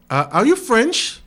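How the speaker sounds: high, drawn-out asking voice; curious questioner. Retold or imitated. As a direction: mimic a stranger asking where you are from. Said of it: "they hear my accent".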